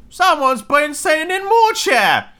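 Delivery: very high, slow squawking bear; middle-sized bear. Acted. Middle-sized bear finding the cushion squashed, high drawn-out voice. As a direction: pitch it way up and stretch it out. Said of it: "in his middle-sized voice".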